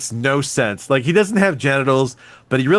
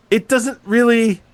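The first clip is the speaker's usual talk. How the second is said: ordinary talk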